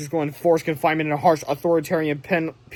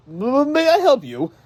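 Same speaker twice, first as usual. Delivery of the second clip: slow, higher, loud and shaky start; nervous stammer; 8 semitones higher, 6 dB louder, slower than usual. Acted. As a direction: stammer it like a scared official.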